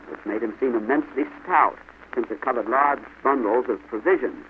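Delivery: ordinary delivery, nothing marked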